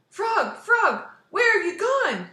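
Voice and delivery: high, loud anxious call; desperate calling. Acted. Toad calls for vanished Frog, high anxious shout. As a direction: call out for a lost friend.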